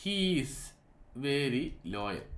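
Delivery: drawn out, word by word, as if writing it; slow dictation. Shown as a demonstration. Teacher writing an example sentence on the board, slowly. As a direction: say it slowly while chalking it up. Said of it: "ഞാൻ അവിടെ ഒരു sentence എഴുതുവാണ്".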